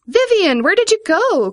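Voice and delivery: put-on high, loud children's cry; calling out. A narrator's reading. Children to returning Vivian, calling out high. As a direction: call out high and eager. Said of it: "cried all the boys and girls".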